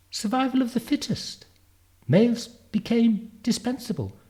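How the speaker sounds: put-on very high, loud, brisk-minded future girl; Zanzi. Girl sending Darwin back, high, urgent child voice.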